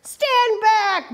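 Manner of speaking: high, commanding call; booming order. A narrator's reading. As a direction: bark it to make them step back. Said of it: "boomed a voice".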